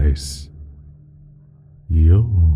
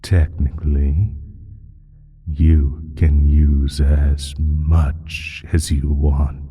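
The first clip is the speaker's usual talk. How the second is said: ordinary talk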